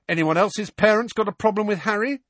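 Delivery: high, challenging question; aggressive. A narrator's reading. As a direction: challenge the whole room. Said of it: "he said aggressively".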